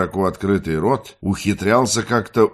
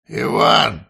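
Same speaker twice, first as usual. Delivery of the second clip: громкий зов через дверь; позвать громко, как через закрытую дверь; крик через дверь; 4 semitones higher, 5 dB louder than usual. Acted. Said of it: "крикнул Валерка из-за двери". (громко кричит через дверь)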